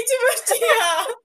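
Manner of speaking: high, breaking laugh through tears; real laughing sob while retelling; overcome, laughing and crying at once. Real, not acted. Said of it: "Aí eu comecei a chorar".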